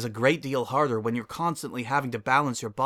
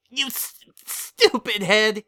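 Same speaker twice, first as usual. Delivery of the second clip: put-on high kid squeal through giggles; wheezing laugh; 9 semitones higher, 7 dB louder, slower than usual. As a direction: sputter it out between wheezes. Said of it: "he wheezes".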